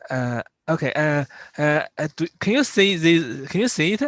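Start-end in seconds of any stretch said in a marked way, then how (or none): none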